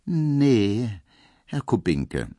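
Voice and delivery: a put-on girlish maid; coy maid Pauline. A narrator's reading. Pauline voiced by narrator.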